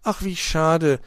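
put-on high, pouting child's disappointment; sulking. A narrator's reading. Young detective wanting the case alone, sulking. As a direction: grumble loudly like a child cheated of glory. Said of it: "schmollte Borstel".

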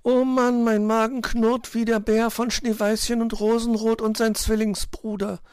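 high, loud whining chirp; exasperated piping bird; complaining little bird. A narrator's reading. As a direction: moan long and high about hunger. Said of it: "beschwerte sich Zwitschi".

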